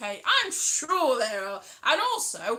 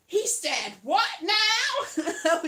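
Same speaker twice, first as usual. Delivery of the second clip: very slow, higher, louder; mock reality-show shock; 6 semitones higher, 4 dB louder, much slower than usual. Vlogger mocking reality TV drama, aghast. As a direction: gasp it out like a reality star. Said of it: "shows where they're just like".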